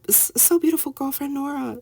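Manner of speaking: high-pitched imitation of an adoring schoolboy; imitating a smitten student. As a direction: mimic the boy gushing, voice way up high. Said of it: "now he's like".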